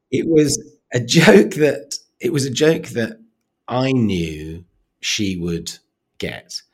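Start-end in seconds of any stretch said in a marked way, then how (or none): 0.1-1.9 high, slow words with a laugh breaking in. Real, not acted. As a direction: laugh through it, loud and slow. Laughs while telling dark joke.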